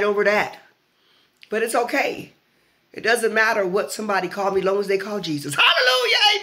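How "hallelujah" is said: sudden church hallelujah, pitched way up; exultant praise cry. Real, not acted. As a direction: let it leap up high out of calm talk. Praise outburst amid calm talk.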